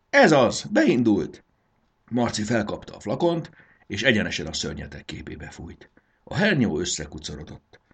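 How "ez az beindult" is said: high, loud burst of relief; triumphant cry. A narrator's reading. Marci sees the spray working, exclaiming excitedly.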